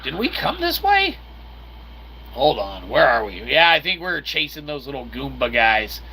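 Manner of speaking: ordinary delivery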